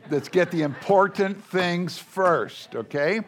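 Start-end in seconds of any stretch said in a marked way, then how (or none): none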